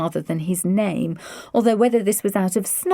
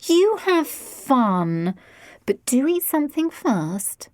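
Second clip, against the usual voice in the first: doting mother; 6 semitones higher, slower than usual. A narrator's reading. Mother indulging daughter.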